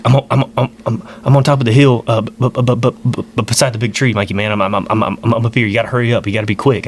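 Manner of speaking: imitated stammering panic over a walkie-talkie; freaked-out stutter. Uncle radioing his nephew for help, freaked-out stutter. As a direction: trip over the first sounds, urgently imitating him. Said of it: "he, like, stutters like that, like he's freaked out".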